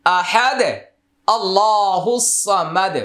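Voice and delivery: আহাদ cut short with a stop, then a slow আল্লাহু সামাদ; stopping pause. Shown as a demonstration. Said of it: "যদি আমরা ওয়াকফ করি".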